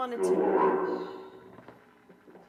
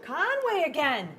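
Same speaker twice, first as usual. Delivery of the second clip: higher and louder, thrilled at the name; excited exclaim; 6 semitones higher, 7 dB louder than usual. Real, not acted. Investigator hearing the priest's name again, excited call. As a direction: burst out at the repeat.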